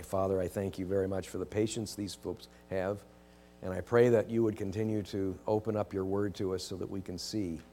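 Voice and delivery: gentle closing prayer. Real, not acted. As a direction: pray quietly to close.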